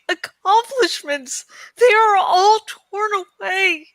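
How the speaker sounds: high, loud, tearful cries of despair; despairing Eragon. Acted. Grieving young rider crying out to his dragon. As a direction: pour out his grief as he pleads.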